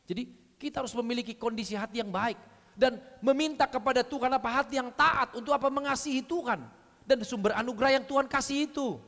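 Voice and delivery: normal talking voice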